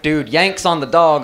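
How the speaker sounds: ordinary talk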